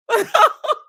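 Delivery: a short, loud, high burst of laughter. Real, not acted. Host laughing at grandma's line, high burst. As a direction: crack up at it.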